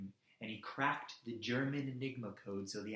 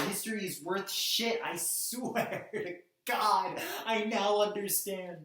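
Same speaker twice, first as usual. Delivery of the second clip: furious outburst; 4 semitones higher, 4 dB louder than usual. Acted. Activist swearing he now understands history, in an impassioned outburst. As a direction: swear it out in angry despair.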